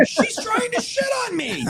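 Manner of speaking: high-pitched mock scream; retold scream. Officer screaming as a suspect tries to soil him, mock scream. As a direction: scream it in a comic, very high panic voice. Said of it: "So I scream".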